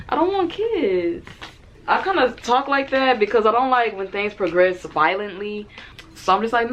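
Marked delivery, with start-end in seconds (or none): 0.1-1.3 high, slowed, emphatic no; sing-song insistence while turning down a kiss; firm refusal. Retold or imitated. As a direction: draw the refusal out so he gets it.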